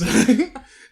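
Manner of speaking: sudden high, loud burst of laughter; real laugh. Real, not acted. Host caught mixing up the year, laughing loudly. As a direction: break into a loud laugh at yourself. Laughs at correction of his mistake.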